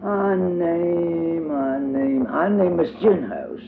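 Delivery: high, slow, stalling for a name; a little one searching for an answer; hesitant toddler voice. A narrator's reading. Tot makes up a name for Diddy, high hesitant toddler voice.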